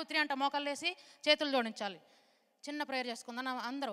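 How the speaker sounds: normal talking voice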